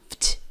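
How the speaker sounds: the ending FT as a quick "vd" with no vowel; isolated VD ending. Shown as a demonstration. Teacher isolates the end of arrived, consonants only. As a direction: release only the last two consonants, FT. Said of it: "Esse é o final".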